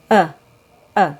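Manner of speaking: short neutral uh, mouth slightly open; just breathe out a weak uh; schwa sound demo. Shown as a demonstration. Teacher showing how to make the schwa. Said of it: "push the air out".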